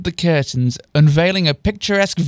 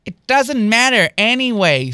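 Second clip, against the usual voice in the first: higher-pitched dismissive sarcasm; sarcastic; 6 semitones higher than usual. A narrator's reading. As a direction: wave it away with a sarcastic lilt. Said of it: "with an apparent tone of sarcasm".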